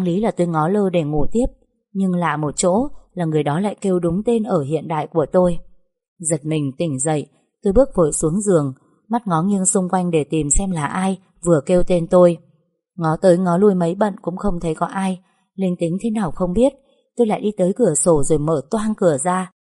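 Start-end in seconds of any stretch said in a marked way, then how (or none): none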